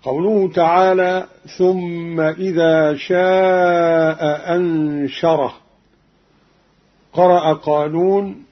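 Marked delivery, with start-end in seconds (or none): 1.6-5.5 slow recitation, long شاء, then a separate hamza into أنشره; both hamzas sounded. Shown as a demonstration. Teacher quotes the verse before Qalun's variant, both hamzas kept. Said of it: "بإسقاط الهمزة الأولى".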